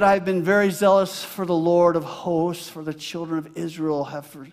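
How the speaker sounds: high, loud preaching of scripture; preaching Elijah's lament. Acted. Pastor reading Elijah's words to the church, loud. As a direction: declaim the prophet's complaint.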